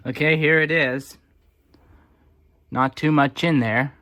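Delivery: usual speaking voice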